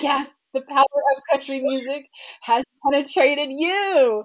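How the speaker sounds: loud, high, triumphant announcement; mock grand proclamation. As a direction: proclaim it like a victory, hammed-up. Teasing mock proclamation over friend crying.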